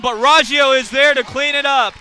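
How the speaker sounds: loud, surging play-by-play; excited basket call. Real, not acted. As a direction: belt out the putback as it drops. Live call of a putback basket.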